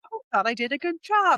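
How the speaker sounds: a hint of a clueless, pleased husband; innocent husband. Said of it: "of course he's like".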